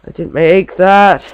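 loud, high, drawn-out exclamation; startled outburst. Real, not acted. Player caught out by something unexpected, loud startled cry. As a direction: blurt it loudly in alarm, stretching the words.

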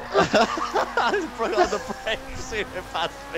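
high-pitched, words breaking through real laughter; helpless real giggling while talking; laughing hard. Real, not acted. Gamer telling friends about a braking mishap, laughing hard. Friends crack up after a race.

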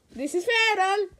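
normal talking voice

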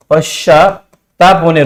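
पश्चात्ताप slowed and split in two, पश्चा, then ताप; slow dictation. Shown as a demonstration. Teacher repeating a meaning for students, slow dictation. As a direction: say पश्चात्ताप होणे slowly, in separate pieces.

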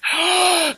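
high, hammed-up gasp; mock gasp. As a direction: gasp in fake shock.

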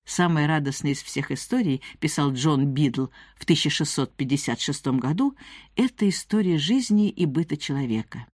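usual speaking voice

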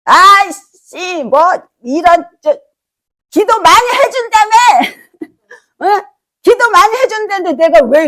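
mock the furious phone complaint; angry caller. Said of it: "어떤 분이 이제 저한테 전화합니다". (화난 신도의 전화를 흉내 내며 따지듯)

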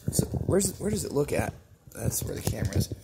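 whispered aside to himself; whispering. Real, not acted. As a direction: whisper it under your breath. Quiet aside finding camera.

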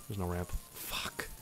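dejected whisper. Real, not acted. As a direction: breathe it out, defeated.

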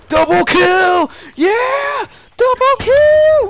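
high, slow, screaming victory yell; real triumphant shout. Real, not acted. Celebrating a double kill.

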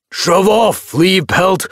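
loud, high, annoyed shout; angry hiss. A narrator's reading. Tom warns off a barking dog, angry hiss. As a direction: hurl it as a fierce brush-off. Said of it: "Billy Storm hissed".

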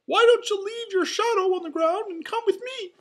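very high, loud cartoon mouse voice; Mickey. A narrator's reading. Mouse cheering up his friend, high cheery squeak. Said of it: "Mickey said".

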